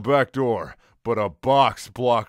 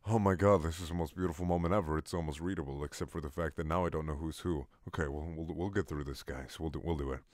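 much softer, fast mumbling aside; quiet aside; 6 semitones lower, 10 dB quieter, much faster than usual. Real, not acted. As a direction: mutter to the viewers, fast and low.

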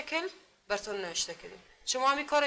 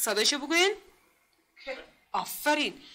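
ordinary talk

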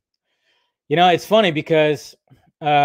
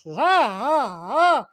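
mock whimpering huffs; 9 semitones higher, much slower than usual. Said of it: "Biden impression".

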